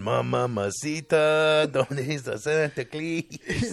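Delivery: slow, drawn-out mock Spanish; mock Spanish accent. Guessing what the caller heard, slow mock Spanish accent. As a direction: imitate a Spanish phone line, slowly. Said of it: "I thought maybe they clicked and went".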